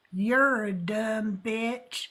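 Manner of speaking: slow, drawn-out mock southern drawl. Retelling troll to Nick, slow southern drawl. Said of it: "he went like this".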